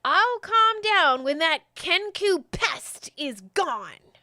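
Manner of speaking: high, loud and slow, seething. Acted. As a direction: spit it out, furious at the Kenku. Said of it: "in a different voice".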